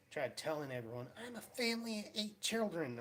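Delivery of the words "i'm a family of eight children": whiny excuse voice. Retold or imitated. Retelling shoplifter's excuse.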